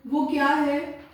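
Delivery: normal talking voice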